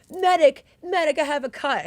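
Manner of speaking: hammed-up shout for a medic over a small cut; mock yell for a medic. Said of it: "he's like".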